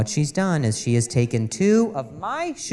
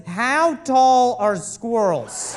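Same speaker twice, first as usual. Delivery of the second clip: slow, louder, higher reading of the search; mock earnest question; 5 semitones higher, 4 dB louder, much slower than usual. Husband reading wife's search aloud, slow mock disbelief. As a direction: read it out in disbelief, drawn out. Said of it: "a Google search that says".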